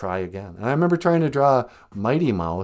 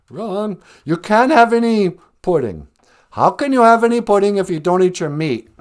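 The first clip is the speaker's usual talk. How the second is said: loud, higher bellowing schoolmaster; raised-voice teacher scolding pupils; 8 semitones higher, 7 dB louder than usual. Retold or imitated. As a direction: shout the pudding line at the class. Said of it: "headmaster in the Pink Floyd The Wall video".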